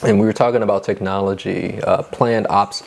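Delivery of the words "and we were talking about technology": ordinary talk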